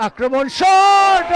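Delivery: slow, stretched-out loud shout. Real, not acted. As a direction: yell the shot out long. Live commentary, shot.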